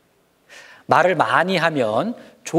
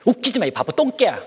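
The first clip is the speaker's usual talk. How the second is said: mocking insult; 7 semitones higher than usual. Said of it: "그렇게 막 얘기하고".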